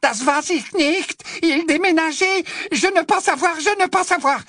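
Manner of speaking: panicked German woman, high and loud; terrified German accent. Acted. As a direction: beg for your life in broken French. Terrified foreign woman begs.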